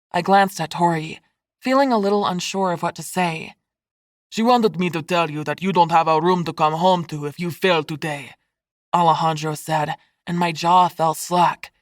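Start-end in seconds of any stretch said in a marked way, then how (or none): 4.3-8.3 put-on Spanish-accented uncle, drily disdainful; hint of a cold Spanish uncle; lightly voiced stern uncle. A narrator's reading. Alejandro's figure voice.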